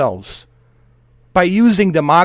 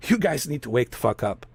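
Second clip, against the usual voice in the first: much softer and lower, resigned; quiet repeat; 7 semitones lower, 10 dB quieter than usual. Real, not acted. Urging his viewers again, quiet and resigned. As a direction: drop it to a low murmur.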